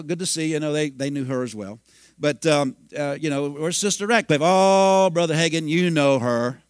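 ordinary talk

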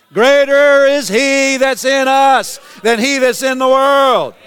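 slow, high, loud proclamation; declaimed scripture. Real, not acted. Preacher declares the verse to the church, slow and loud. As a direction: proclaim it like a banner.

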